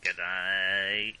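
lightly put-on Aussie drawl; a hint of Australian bloke; laconic outback drawl. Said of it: "doing the accent".

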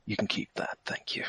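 soft, almost whispered thanks; quiet. Acted. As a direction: say it under your breath. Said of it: "and say".

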